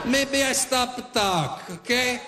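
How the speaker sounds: a hint of a menacing Italian father; Italian dad. An Italian dad threatening a rude stranger in a light Italian accent. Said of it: "My Italian dad right away".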